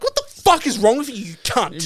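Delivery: high, loud retold outburst; angry outburst. Customer walking off from the shop worker, angry retold outburst. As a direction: burst out at him, voice leaping up.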